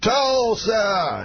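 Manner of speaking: high, held crowd-rousing call; Bill Cosby. Retold or imitated. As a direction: imitate a comic calling the town's name to a stadium. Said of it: "he says it about five times".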